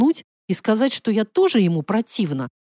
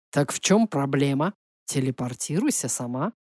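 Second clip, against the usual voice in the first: low, soft mock innocence; innocent provocation; 6 semitones lower, 4 dB quieter than usual. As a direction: needle her quietly, all innocence. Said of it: "Он был сама невинность и при этом открыто провоцировал". (низко и тихо, с притворной невинностью)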